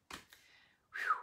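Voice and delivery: a high, airy whew of relief; relieved whew. Acted. Telling the class the hands are healed, relieved whew. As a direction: breathe out relief like a weight just lifted.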